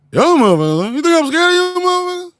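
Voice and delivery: high, loud comic bluster; hammed-up, high-pitched yelled impression; Bernie Mac. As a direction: shout it like a defiant stand-up comedian. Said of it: "the greatness of Bernie Mac".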